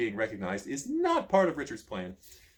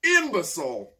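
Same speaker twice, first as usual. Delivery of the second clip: yell; 4 semitones higher, 7 dB louder than usual. A narrator's reading. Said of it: "yells at you".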